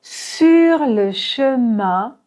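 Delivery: Sur le chemin very slowly, nasal IN held; slow nasal demo; nasal vowel in chemin. Shown as a demonstration. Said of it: "c'est un son nasal".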